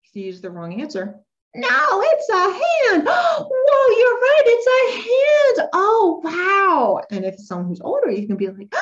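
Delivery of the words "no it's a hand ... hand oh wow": loud, high, drawn-out amazement; exaggerated celebration with gasps; really excited. Shown as a demonstration. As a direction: squeal as if the child got it right. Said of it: "I'm gonna be making myself really excited".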